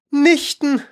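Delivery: high, falling exclamation; calling out. A narrator's reading. Alice mishearing the mouse, crying out curiously. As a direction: cry out with eager curiosity. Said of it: "rief Alice".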